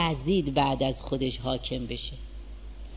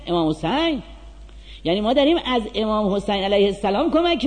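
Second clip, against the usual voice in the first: higher, louder, driving sermon voice; fiery preaching; 6 semitones higher, 6 dB louder, faster than usual. Acted. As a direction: preach it hard to the congregation. Preaching at full force.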